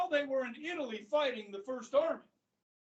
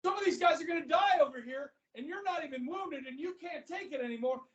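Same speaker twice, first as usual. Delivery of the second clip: indignant scolding, pitch climbing; Patton; 6 semitones higher than usual. Retold or imitated. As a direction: berate him in mock disbelief. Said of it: "And Patton's like".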